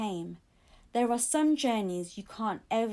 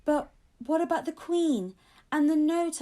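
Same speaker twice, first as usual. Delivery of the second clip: cried out; 6 semitones higher, 5 dB louder than usual. Acted. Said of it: "I cried".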